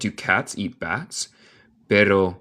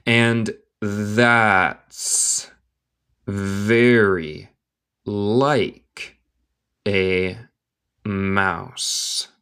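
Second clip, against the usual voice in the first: very slow, each word standing alone; word-by-word dictation; much slower than usual. Shown as a demonstration. Teacher reading Alice to learners, word by word. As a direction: pause after every word for learners.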